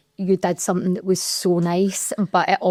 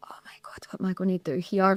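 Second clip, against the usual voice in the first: whispered inner panic; panicked whisper; 6 dB quieter, faster than usual. Retold or imitated. Hearing the coach is leaving, whispering her panic to herself. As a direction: breathe it out as a frightened thought.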